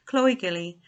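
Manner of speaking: ordinary delivery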